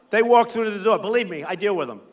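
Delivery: normal talking voice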